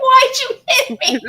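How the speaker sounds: high-pitched retold protest through laughter; laughing protest. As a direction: squeal the complaint while laughing. Retells protest while laughing.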